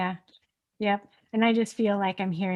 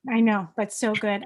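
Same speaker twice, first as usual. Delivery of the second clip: normal talking voice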